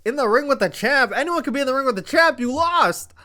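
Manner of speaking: high, loud scoffing retort; mocking disbelief. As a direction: tear the boast apart, incredulous. Mocking Melo's boast incredulously.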